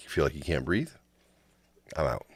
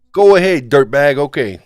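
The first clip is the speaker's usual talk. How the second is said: loud; 10 semitones higher, 14 dB louder than usual. Acted. Mock snap back at host.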